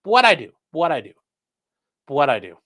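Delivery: swooping comic wail, fading away; comic catchphrase trailing off in steps; MadTV lady impression. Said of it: "he's like that lady on Mad T V".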